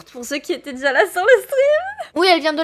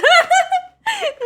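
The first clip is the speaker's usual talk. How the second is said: high laugh. Real, not acted. Laughing at chat's question.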